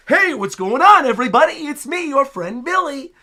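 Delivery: hammed-up hyper cartoon hero introducing himself; over-animated Billy. Streamer mimicking the over-animated Billy greeting everybody, hammed-up. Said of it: "super over animation of like".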